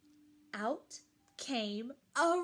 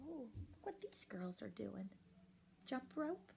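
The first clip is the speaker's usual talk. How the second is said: whisper; 4 semitones lower, 13 dB quieter, faster than usual. Real, not acted. Aside to child about picture.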